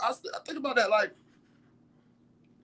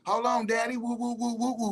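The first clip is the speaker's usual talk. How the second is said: higher, playful New York hype man; 6 semitones higher than usual. Retold or imitated. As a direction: hype it up like a New York rapper, woo-ing. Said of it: "[name] and [name] and all of them".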